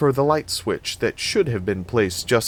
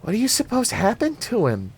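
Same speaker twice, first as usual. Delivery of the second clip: put-on high-pitched small child's question; little girl; 14 semitones higher than usual. A narrator's reading. Little girl asks Annie about the dog, high child's voice. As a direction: ask it in a very high little-kid voice. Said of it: "the little one asked".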